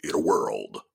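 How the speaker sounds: deep, quiet movie trailer narrator; low and ominous like an epic trailer; imitating the "in a world" trailer guy. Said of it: "The other guy used to go".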